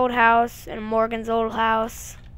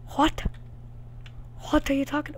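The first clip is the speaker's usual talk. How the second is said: usual speaking voice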